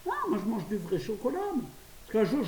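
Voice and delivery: normal talking voice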